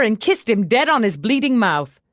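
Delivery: ordinary delivery, nothing marked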